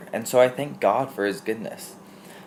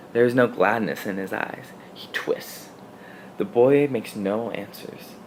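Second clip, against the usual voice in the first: ordinary delivery, nothing marked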